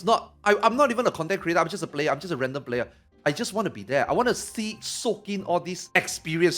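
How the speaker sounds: ordinary talk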